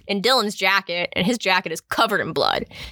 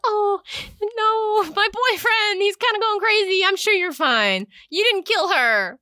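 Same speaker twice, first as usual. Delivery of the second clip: high, airy brush-off voice; dismissive Bliss; 12 semitones higher than usual. Retold or imitated. Bliss brushing off her boyfriend in a high airy voice. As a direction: breezily wave it away in a high voice. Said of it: "Bliss is like".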